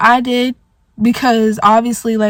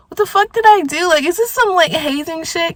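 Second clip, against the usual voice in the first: high-pitched indignant questioning; indignant disbelief; 7 semitones higher than usual. Retold or imitated. Retelling being left out by the group, indignant disbelief.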